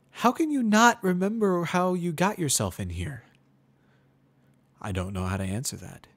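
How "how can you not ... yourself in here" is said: put-on much higher, louder young mare, forced friendly; Twilight Sparkle. A narrator's reading. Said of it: "Her voice sounds a little more strained".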